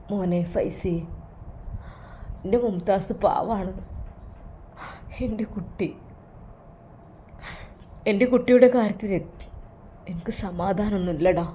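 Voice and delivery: a father pouring out his worry; sorrowful old father. Acted.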